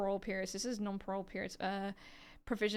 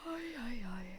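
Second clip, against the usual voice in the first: whispered ay ay ay; 6 dB quieter than usual. Real, not acted. Alone at desk, frustrated sigh.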